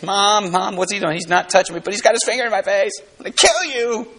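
put-on high, whining child tattling to mom; whiny kid sibling. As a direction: play the kid brother yelling for mom. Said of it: "your brother would put his finger up in your face".

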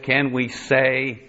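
ordinary talk

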